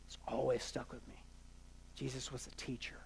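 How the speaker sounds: very low and soft, fading to near whisper; hushed reflection. Real, not acted. Preacher recalling advice that stuck with him, hushed and reflective. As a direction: let the remembered words sink in quietly.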